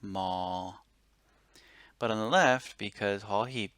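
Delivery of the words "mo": mo with a Thai flat mid tone. Shown as a demonstration. Teacher modelling mo on a flat tone. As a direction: hold mo level in mid voice. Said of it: "it would be a flat tone".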